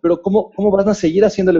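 hammed-up high concern; imitated worried guest. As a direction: fuss over the tragedy. [name]'s concerned questions.

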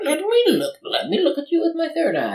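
mystic voice. Acted. Co-host voices mystic Davis.

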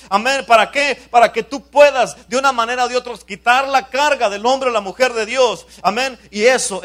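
loud, high, driving sermon delivery; full-force preaching. Real, not acted. Pastor urging the church to learn and teach others, forceful preaching. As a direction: preach it out loud and high, punching each phrase.

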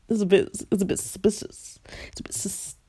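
tongue-tied, tripping over the word; stuttering. Real, not acted. Vlogger commenting on teachers' pattern, stuttering over suspicious. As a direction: stumble and restart on the hissy s sounds. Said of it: "I'm stuttering".

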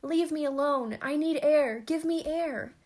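high, very loud plea; distressed cry. A narrator's reading. Choking lover to his helpers, loud desperate cry. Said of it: "cried the now not-so-manly lover".

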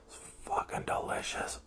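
hushed, emphatic whisper. Real, not acted. Traveler raving about the food he ordered, whispered. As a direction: whisper it like a savoring aside.

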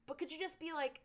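ordinary talk

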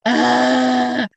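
sharp gasp, then long mock wail; dramatic fake cry. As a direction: imitate a kid's over-dramatic fake crying. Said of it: "they are very dramatic".

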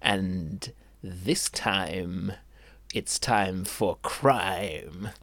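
very slow, low trailer voice; dramatic slow narration. Acted. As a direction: drag it out like a movie trailer.

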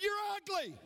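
very high taunt with a fall; acted high holler. Boy taunting the unseen voice, high holler. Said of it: "he screamed out".

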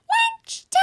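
very high, loud repeated call; piercing squeaky mum call. Acted. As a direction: holler for the kids in a squeak. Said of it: "Mummy called them again".

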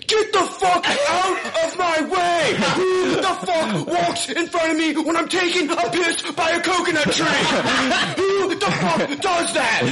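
high-pitched, slow mock yelling; drawn-out high imitation of a furious shout; mock rant. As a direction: rant like a fed-up castaway at his roommate. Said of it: "he's yelling at you".

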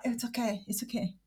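softly reassuring herself; quiet self-soothing. Retold or imitated. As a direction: murmur it to calm yourself down. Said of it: "I'm like".

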